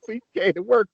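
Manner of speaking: normal talking voice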